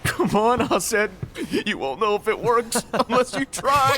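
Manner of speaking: teasing through laughter. Acted. Teasing Aced to growl.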